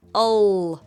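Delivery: 얼 held loud with the tongue up; L sound demo. Shown as a demonstration. Teacher showing the L sound, loud 얼. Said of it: "무슨 말이냐면".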